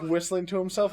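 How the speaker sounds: ordinary delivery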